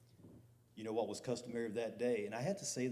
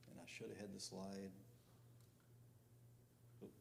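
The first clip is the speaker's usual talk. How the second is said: ordinary delivery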